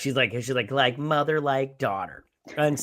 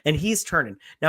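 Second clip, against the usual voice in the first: ordinary delivery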